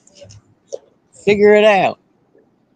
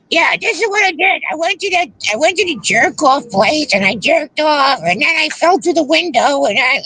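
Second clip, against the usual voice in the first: Teamster Tim impression; 7 semitones higher than usual. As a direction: yell it like a gruff trucker. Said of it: "was that your Teamster Tim impression".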